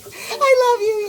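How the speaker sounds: high, loud, slow and teary; tearful gush. Retold or imitated. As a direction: choke it out overcome with relief. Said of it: "it really is like".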